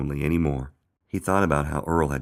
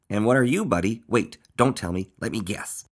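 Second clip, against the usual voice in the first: lightly voiced lively story character, pitched up; higher, animated character voice; eager guesser in a lifted voice; excitable Earl; 6 semitones higher than usual.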